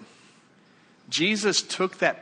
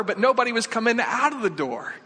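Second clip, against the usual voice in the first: high-pitched, emphatic storytelling; heightened suspense; 7 semitones higher, 4 dB louder than usual. Real, not acted. Preacher repeats about the courtroom door, heightened suspense. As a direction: drive the ominous detail home, voice raised.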